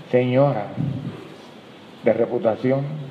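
ordinary talk

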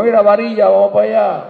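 high, loud schoolyard jeer; mocking child. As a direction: call out the nickname like a teasing kid. Kids mocking a skinny boy.

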